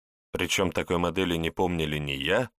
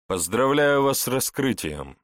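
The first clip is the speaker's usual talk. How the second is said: raised, mildly snide congratulation; 6 semitones higher, 4 dB louder than usual. A narrator's reading. Investigator congratulates the security officer, snidely. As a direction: offer higher-pitched congratulations with a sting. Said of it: "ехидно добавил".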